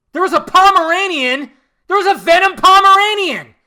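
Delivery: loud, high disbelief; incredulous outburst. Real, not acted. Ranting to viewers in loud disbelief. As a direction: shout it in disbelief. Incredulous about Venom scene.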